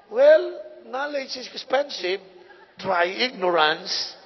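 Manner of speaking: slow, loud, emphatic proverb; slow preacher's punchline; preached punchline. Real, not acted. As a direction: drive the lesson home slowly. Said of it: "Ignorance is more expensive".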